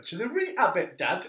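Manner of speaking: high, loud Geordie lad; Geordie accent. Retold or imitated. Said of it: "if you imagine this in a Geordie accent".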